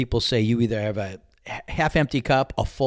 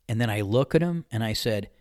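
ordinary talk

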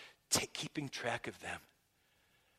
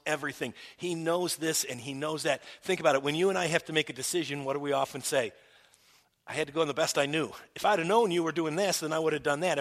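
preaching; 3 semitones higher, 7 dB louder, faster than usual. Real, not acted. Pastor proclaiming God's knowledge to the congregation, loud. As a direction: proclaim it to the back row. Preaching, mock excuses fast.

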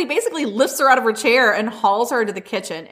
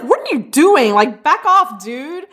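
louder, higher mock challenge; mock angry yell; 6 semitones higher, 4 dB louder, slower than usual. Lockwood hauling Kit up, a mock angry shout. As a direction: bark at him to back off, half-hammed.

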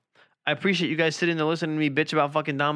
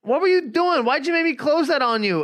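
much higher, louder mock girlfriend voice; 13 semitones higher, 5 dB louder than usual. Boyfriend mimics girlfriend's question, high mocking voice. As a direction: squeak it out like you're imitating her. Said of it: "she's like".

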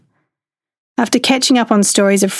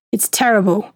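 put-on Italian accent. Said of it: "in a very thick Italian accent".

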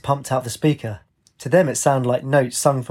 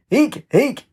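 high bird squeaks, much higher pitch; 12 semitones higher than usual. A narrator's reading. Girl trying to turn into a bird, high squeaks. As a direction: squeak like a little bird, flapping. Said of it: "she squeaked".

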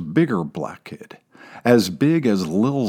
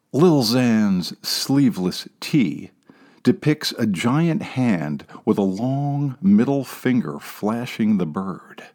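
ordinary delivery, nothing marked

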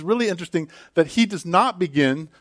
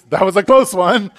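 louder and higher, laughing relief; preacher jokingly voices Jesus relieved after the crowd leaves; mock relief; 6 semitones higher, 8 dB louder than usual. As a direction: play it as a narrow escape with a laugh.

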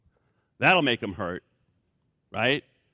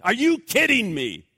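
indignant disbelief; 5 semitones higher, 4 dB louder than usual. Acted. Flesh protests again.

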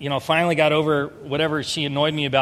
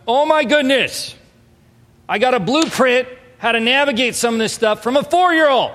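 delighted disbelief, raised; astonished exclaim; 6 semitones higher, 4 dB louder than usual. Retold or imitated. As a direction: marvel out loud at it. Astonished realization retold.

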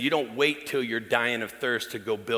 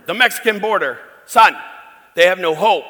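put-on much louder and higher, fervent old father; imitating dad; 7 semitones higher, 12 dB louder than usual. Pastor imitating his father's fervent plea for hopeless kids, loud. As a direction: plead it with preacher's fire. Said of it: "I said".

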